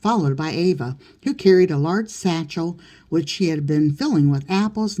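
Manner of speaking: ordinary delivery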